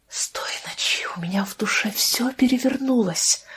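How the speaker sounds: whisper, words squeezed out; strained whisper. A narrator's reading. Doctor struggles to confess to Лия, whispering with effort. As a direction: force the words out under the breath. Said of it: "прошептал доктор, с видимым трудом".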